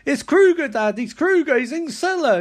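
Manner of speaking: high, loud acted warning; mock-panicked alert; urgent alarm. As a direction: cry out the danger to dad. Daughter alerting father to the killer.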